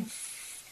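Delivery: soft unvoiced hiss of a deodorant spray; spray can hiss. Teenager spraying deodorant at a moth, hissing spray sound. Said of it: "Le echaba, le echaba así".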